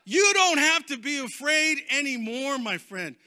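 loud, high preaching cry; forceful exhortation. Real, not acted. Preacher urging listeners past fear, forceful exhortation. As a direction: proclaim it to the whole room.

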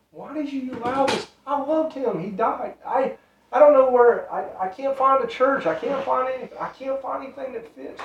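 high, pleading voice on the edge of tears; a widow's anguished, high-pitched plea to God; despairing plea. Retold or imitated. Retelling Brenda's despairing cry to God.

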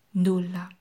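usual speaking voice

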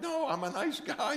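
high squeaky protest; pleading in a high voice; mock high-pitched plea. Pastor's pleading reply.

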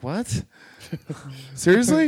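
can't believe it; retold disbelief. Comic hearing he finally passed, surprised.

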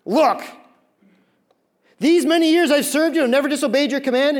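loud, high-pitched indignant complaint; angry older son. Acted. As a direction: act out a resentful son protesting to his father. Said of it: "But he was angry".

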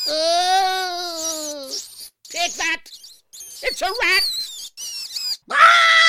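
high loud triumphant yells; gleeful shouting. Acted. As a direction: shout in wild glee.

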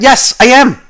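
loud, high-pitched rant; really getting angry. Real, not acted. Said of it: "am I really getting angry at that".

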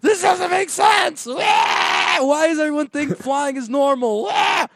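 high, drawn-out mock screaming with wails; imitated screaming. Voicing the kid who never flew before, high screamed complaint. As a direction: scream the words slowly at high pitch. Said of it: "what he was screaming about".

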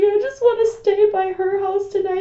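put-on high, small, begging little-girl voice; pleading kid voice. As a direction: plead with Dad like a child. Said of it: "was like".

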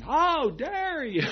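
high, loud, slow outraged scream. Retold or imitated. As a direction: shriek it at the driver who cut you off. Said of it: "And I screamed".

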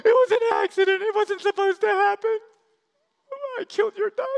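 high, loud, tearful wail; crying confession. Acted. Little Johnny confesses to Grandma, crying. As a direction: blurt it out through sobs. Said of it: "literally shaking".

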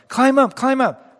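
high, loud shout up to him; yelled call. Retold or imitated. Helicopter rescuer shouting to the man below, high and loud. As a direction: shout over the helicopter noise. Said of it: "the rescuer yelled".

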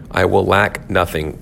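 usual speaking voice

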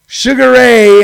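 lightly hammed-up fight intro; ring announcer. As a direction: announce him like a boxer. Announcing the cat like a boxer.

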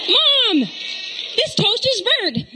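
high, slow whiny complaint; complaining daughter. Retold or imitated. Mom imitating her daughter complaining about burnt toast. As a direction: drag out the complaint like a sulky kid.

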